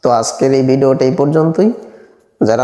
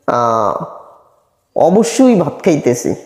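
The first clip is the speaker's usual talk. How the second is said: ordinary talk, nothing marked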